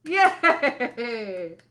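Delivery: ordinary delivery, nothing marked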